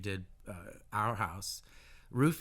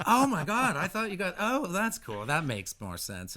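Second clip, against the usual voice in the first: much higher, louder excited squeal; excited friend; 9 semitones higher, 7 dB louder than usual. Retold or imitated. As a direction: gush like a delighted friend. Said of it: "And she's like".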